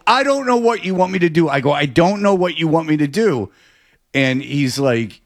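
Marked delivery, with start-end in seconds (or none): 0.1-1.5 mild retold yell. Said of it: "You shouldn't have yelled at him". 1.7-3.5 mild mock yell. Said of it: "You shouldn't have yelled at him".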